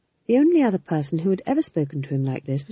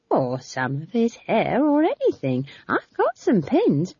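put-on higher, slow eager old woman; eager old witch; 4 semitones higher, slower than usual. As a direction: suggest it eagerly like a gossipy witch. Nanny Ogg eager voice.

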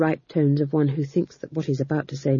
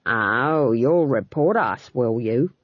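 low, slow mocking threat; sneering; 5 semitones lower, slower than usual. A narrator's reading. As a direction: taunt him. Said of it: "said Huron".

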